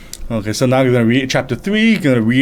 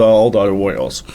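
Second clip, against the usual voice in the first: ordinary delivery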